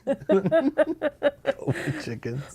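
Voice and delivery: high, slow laughing delight. Real, not acted. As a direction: giggle the name out in disbelief.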